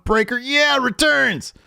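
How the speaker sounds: very high, loud hype burst; mock hype. Host hyping a champion's return, high mock excitement. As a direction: blurt the name like over-the-top hype.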